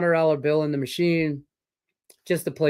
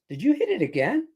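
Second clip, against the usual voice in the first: higher, amazed question; buddy's surprise; 8 semitones higher than usual. Retold or imitated. Buddy at the bar asks if he hit again, amazed and higher. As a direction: play the buddy, stunned. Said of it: "my buddy goes".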